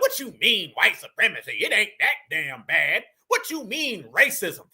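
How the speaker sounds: low-pitched mock doubter; mocking imitation. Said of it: "they would say that in every accent".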